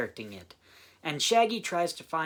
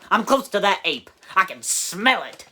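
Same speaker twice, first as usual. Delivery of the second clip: sheriff voice; 5 semitones higher, 9 dB louder than usual. Retold or imitated. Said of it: "the fake sheriff's line".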